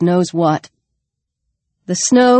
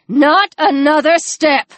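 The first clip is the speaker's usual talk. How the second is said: slow, higher-pitched firm warning; stern command; 6 semitones higher than usual. A narrator's reading. Jess aims the rifle at Alex, slow stern command. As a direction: order it slowly, each word weighted.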